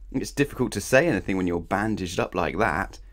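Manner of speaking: usual speaking voice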